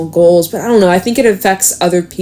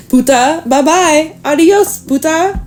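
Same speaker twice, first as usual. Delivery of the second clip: high, lilting taunting goodbye; playful, pitched-up kiss-off; mocking farewell; 9 semitones higher, slower than usual. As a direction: sing out a cheeky send-off in a much higher voice. Playful insulting farewell, acted.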